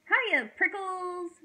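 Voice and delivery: high, loud, stretched-out holler; shouted greeting. A narrator's reading. Jackrabbit dashing by Hank, shouting hello. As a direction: holler a long-drawn hello. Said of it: "she shouted".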